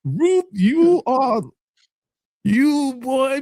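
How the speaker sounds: high-pitched, drawn-out amused disbelief; mock amazement. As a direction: stretch each word up high in playful awe. Acting out own amazed reaction to Ruth.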